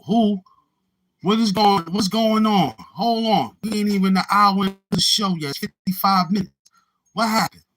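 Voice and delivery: loud and high, baffled by the chat; high-pitched bewildered shouting; high surprised outburst. Real, not acted. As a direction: shout it out, thrown off.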